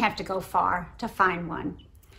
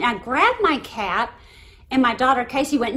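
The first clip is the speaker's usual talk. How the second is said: ordinary delivery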